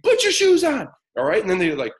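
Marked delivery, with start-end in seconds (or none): 0.0-0.9 high, loud imitated yell; raised-voice yell. Parent ignored by child, yelling the order louder. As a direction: escalate into an exasperated parental shout. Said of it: "I raise my voice a little bit more".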